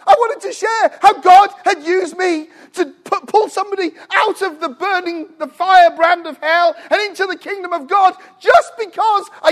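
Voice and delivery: high, loud shouting like an eager donkey; wildly excited. Retold or imitated. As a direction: burst out, jumping to testify. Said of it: "I'm jumping".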